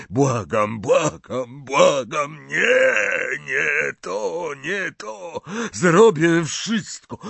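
rising, pleading voice that breaks into a high cry; desperate begging. A narrator's reading. Dumbledore begging Harry to stop, desperate pleading. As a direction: beg like a tormented old man.

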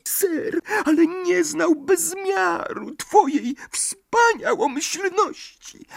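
very high, whimpering elf; a frightened servant pleading; tearful house-elf. A narrator's reading. Dobby overwhelmed with gratitude. (płaczliwy skrzat)

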